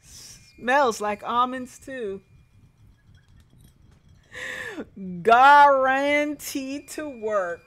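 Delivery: loud, slow, cackling sales pitch; hammed-up pitchman. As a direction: sell poison like a carnival barker. Salesman pitching rat poison.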